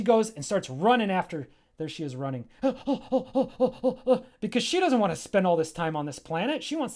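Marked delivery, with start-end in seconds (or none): 2.5-4.4 sharp gasp breaking into a laugh; acted gasp. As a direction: gasp in mock surprise, then laugh. Character spots something, gasps.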